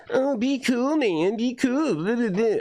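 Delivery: high-pitched mock pleading to chill; mocking imitation. Imitating secular critics telling him to be cool, mocking high voice. Mimicking people who urge calm.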